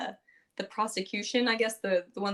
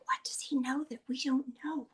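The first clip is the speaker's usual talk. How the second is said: whispered crowd murmur; 4 semitones higher, 5 dB quieter than usual. Retold or imitated. Courtroom crowd murmuring about Kyrian, in a whisper. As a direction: hush it like gossip in the gallery.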